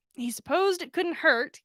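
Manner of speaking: ordinary delivery, nothing marked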